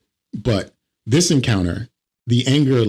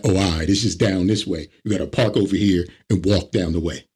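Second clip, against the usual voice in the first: ordinary talk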